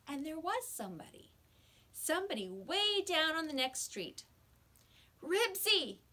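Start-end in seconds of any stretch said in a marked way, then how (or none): 5.2-6.0 high, loud call of a dog's name. A narrator's reading. Henry calling to his lost dog from the car. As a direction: call out to him from far away.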